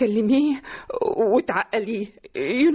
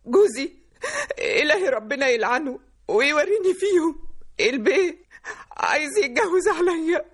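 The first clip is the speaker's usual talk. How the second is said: broken, weeping complaint with gaps for sobs; betrayed wife wailing to her daughter; tearful cursing. Acted. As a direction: sob out the grievance. Betrayed wife curses husband, crying.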